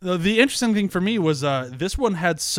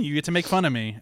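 normal talking voice

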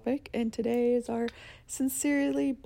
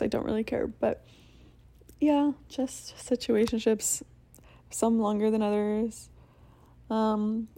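ordinary delivery

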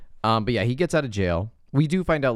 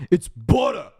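high, loud Boston "butter", r dropped; Boston accent; 12 semitones higher, 6 dB louder, slower than usual. Retold or imitated. Dad correcting the girlfriend, loud mock Boston accent. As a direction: insist on "butter" in broad Boston.

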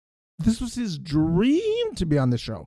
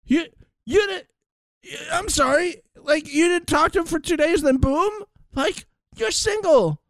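high-pitched mocking impression of Cash; squeaky mocking imitation of a rival; mock high whine; 13 semitones higher than usual.

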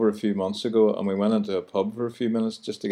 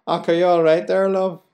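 high, louder sing-song Belfast greeting; friendly Belfast stranger; 9 semitones higher, 4 dB louder than usual. Retold or imitated. Host imitating stranger greeting a woman, high sing-song Belfast. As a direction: lilt it up high on love. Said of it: "for a man to say to a woman".

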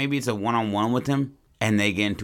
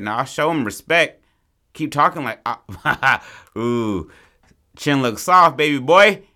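louder, higher mocking taunt; 6 semitones higher, 7 dB louder than usual. Oliver loudly taunts the older brother, mocking. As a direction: ham up a cocky street taunt. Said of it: "He's like".

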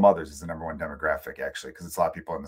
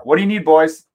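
calling out; 8 semitones higher, 12 dB louder than usual. Real, not acted. Father calls out to his kids.

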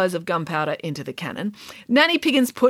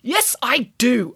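argumentative pig; 4 semitones higher, slower than usual. Acted. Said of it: "argued Nanny Piggins".

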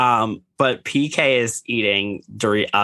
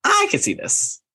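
much higher squeal; squealed agreement. Retold or imitated. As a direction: shriek it out in delight. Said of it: "And I was like".

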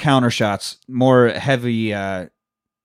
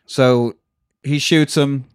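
ordinary talk

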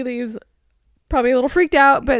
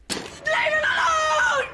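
high, drawn-out mimicked shout; non-American yell; 4 dB quieter, much slower than usual. Demonstrating the film mom's foreign-sounding yell, high and long. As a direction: stretch it out in a high, held-back mock yell. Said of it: "she sounds non-American again".

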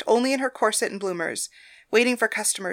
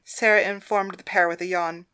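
ordinary delivery

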